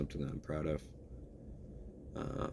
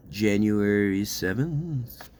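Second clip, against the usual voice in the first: drawn-out musing; 4 semitones higher, 8 dB louder, much slower than usual. Real, not acted. Pondering the date, drawn out.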